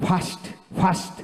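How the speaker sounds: "ফাস্ট" with air pushed out on the opening f; airy f demo. Shown as a demonstration. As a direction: model "ফাস্ট" twice, blowing air through the first sound. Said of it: "বাতাস বের করতে হবে".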